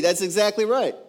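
usual speaking voice

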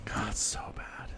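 breathy whispered aside; whispering. Real, not acted. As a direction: whisper it in disgust. Whispered aside about bad movie.

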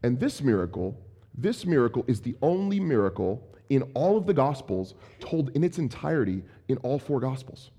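ordinary talk, nothing marked

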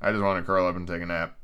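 low, soft, drowsy murmur; sleepy. Real, not acted. Reader wanting to curl up, drowsy and soft. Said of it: "so sleepy".

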